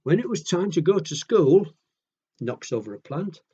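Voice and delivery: ordinary delivery, nothing marked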